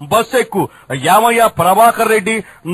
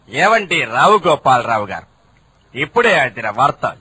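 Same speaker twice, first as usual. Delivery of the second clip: newsreader voice; 8 semitones lower than usual. Acted. Reporter bringing the boss fresh news. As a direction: announce it like breaking news.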